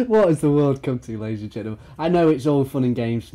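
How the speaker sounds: ordinary delivery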